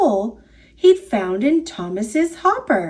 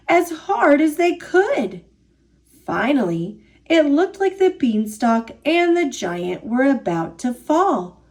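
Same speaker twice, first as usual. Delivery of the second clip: ordinary delivery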